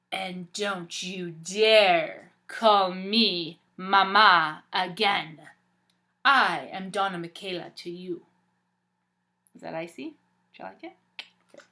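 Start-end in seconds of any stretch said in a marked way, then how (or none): 0.1-8.3 slow, loud and icy stepmother; cold, cutting command. Acted. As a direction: freeze her out with every word. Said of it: "I'm gonna try my icy voice".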